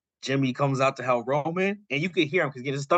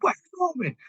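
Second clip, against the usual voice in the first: high-pitched mock outburst; retold snapping. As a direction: imitate someone losing it, voice shooting up. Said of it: "Jimmy snaps, like, loses his shit".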